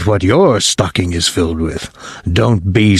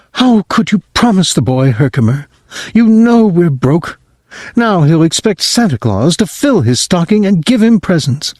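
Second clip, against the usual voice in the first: worried mother; 7 semitones higher than usual. Acted. Mother telling her husband they have no money, higher and anxious. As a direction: fret like a poor mother.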